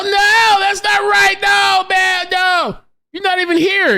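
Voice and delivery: shrill outburst at the screen; high-pitched yelling. Real, not acted. Ranting at a foreign commenter online, loud high yelling. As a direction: yell it high and loud, all worked up.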